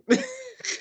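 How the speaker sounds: squealing high laugh; a burst of high-pitched laughter. Real, not acted. Friends laughing at a joke, high squealing laugh.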